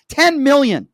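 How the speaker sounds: host repeating the toll, loud; emphatic repeat. Acted. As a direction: hit Ten hard and fast. Host hammers home a number.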